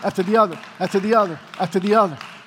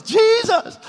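imitating fervent prayer; 10 semitones higher than usual. Acting out Paul praying during the fight, fervent and higher. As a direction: pray as if nothing else is happening. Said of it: "He's like this".

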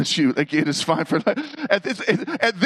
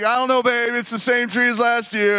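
nervous denial; 8 semitones higher than usual. Retold or imitated. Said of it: "I'm like".

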